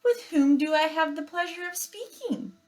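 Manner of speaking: high, bright-mannered robot politeness; friendliest robot voice. Acted. Robot politely asking the bears their names, high and friendly. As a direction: greet them sweetly, like a polite machine. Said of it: "the robot's friendliest voice".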